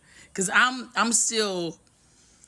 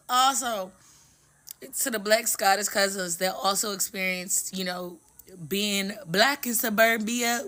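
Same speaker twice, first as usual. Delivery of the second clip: usual speaking voice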